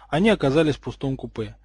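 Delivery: ordinary delivery, nothing marked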